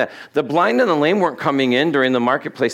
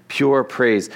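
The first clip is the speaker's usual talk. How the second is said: ordinary talk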